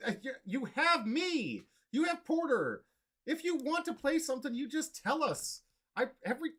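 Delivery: high, excited mock rant; mock outraged pleading; rant mode. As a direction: plead with him in a hammed-up rant. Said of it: "I gotta get back into rant mode".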